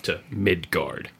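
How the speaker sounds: normal talking voice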